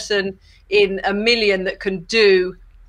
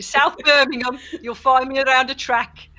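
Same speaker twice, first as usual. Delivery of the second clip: put-on Birmingham accent; 4 semitones higher than usual. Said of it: "That's a Birmingham accent".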